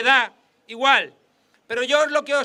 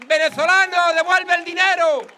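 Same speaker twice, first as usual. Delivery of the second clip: louder, higher hammed-up yelling; mock shout; 4 semitones higher, 4 dB louder than usual. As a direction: bellow the accusation in a parody of a TV pundit. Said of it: "Inda le grita". (grito burlón, más fuerte y más agudo)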